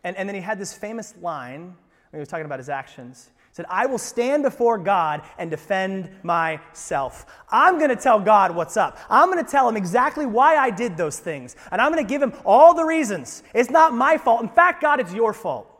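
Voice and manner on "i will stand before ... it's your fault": loud, high-pitched mock defiance; acting out an arrogant man shouting his case at God; defiant boast. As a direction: build into a loud, indignant self-defense. Acted infidel's defiant boast to God.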